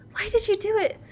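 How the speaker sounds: high-pitched, hurt accusation; accusing young woman. Acted. Sasato confronting the accused over a death, high accusing voice. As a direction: demand an answer in a high, lighter voice.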